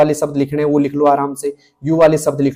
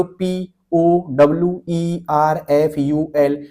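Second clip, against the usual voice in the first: spelled letter by letter; much slower than usual. Shown as a demonstration. Typing teacher shows students how to spell while typing, slow letter by letter. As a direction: spell out पी ओ W ई आर एफ यू एल slowly, one letter at a time. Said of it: "एक एक अक्षर को पढ़ते हुए चलना है".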